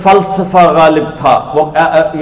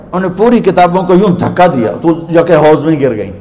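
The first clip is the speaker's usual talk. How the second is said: ordinary delivery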